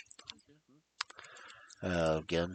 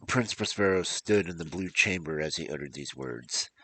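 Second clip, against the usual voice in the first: measured storybook reading voice; story narration; 3 semitones lower than usual. A narrator's reading. Narrator telling the fall of Prince Prospero, measured storytelling. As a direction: read it like a dark tale by candlelight. Reads a gothic tale aloud.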